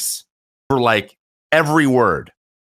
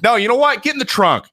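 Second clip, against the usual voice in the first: mock bossy order; 11 semitones higher than usual. Escalated mock order to batter.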